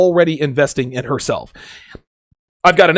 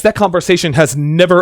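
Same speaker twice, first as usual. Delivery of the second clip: normal talking voice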